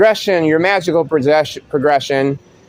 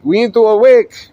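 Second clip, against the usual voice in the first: higher, louder call to awaken; rousing decree; 8 semitones higher, 5 dB louder than usual. Retold or imitated. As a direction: proclaim it like a rousing preacher.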